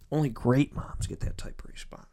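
soft, whispered emphasis; hushed praise. Real, not acted. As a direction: drop to a heartfelt whisper. Repeated line, dropped to a hush.

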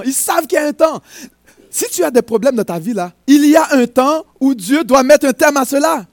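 prêche à pleine voix, plus fort et plus aigu; prédication enflammée. Acted. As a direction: encourager l'assemblée avec ferveur. (le prédicateur exhorte les fidèles, à pleine voix)

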